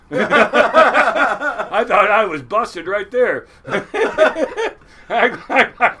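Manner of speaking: real laughter running into the words; loud, amused, cracking up at his own scare; laughing. Real, not acted. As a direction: laugh hard and loud, then get the line out.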